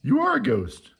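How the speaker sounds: very high, loud scared yell; frightened yell. A narrator's reading. Morris terrified by the ghost, high scared yell. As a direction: shriek it in fright. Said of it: "Morris yelled".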